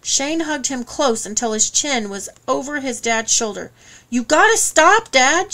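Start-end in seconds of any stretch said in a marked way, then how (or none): 4.1-5.5 higher, louder cry to his father. A narrator's reading. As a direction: plead loudly with him to stop. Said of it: "Shane cried".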